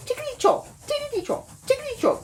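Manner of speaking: very high rattling chant of the coaches. A narrator's reading. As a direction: chant it like little coaches clattering along. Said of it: "said the coaches".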